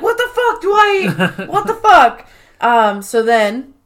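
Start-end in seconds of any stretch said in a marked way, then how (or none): 0.0-2.3 high, loud and laughing shriek; retelling Michael's panic after the slap, high laughing yell; mock freak-out. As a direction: squeal it in shocked outrage. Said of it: "He's like".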